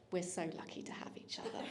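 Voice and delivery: a hint of mum, whispered with a laugh; mum's saying. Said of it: "As Mum would say".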